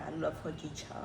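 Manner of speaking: ordinary delivery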